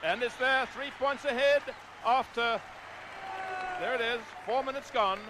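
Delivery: high, loud cry as the kick sails over; excited call. Real, not acted. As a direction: lift the voice in triumph at the goal. Penalty kick goes over.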